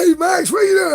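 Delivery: high, loud friendly hail; Bubba calling out. Retold or imitated. Neighbor Bubba hailing Max in the shower, high loud call. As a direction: call out cheerfully across the yard. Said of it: "Bubba goes".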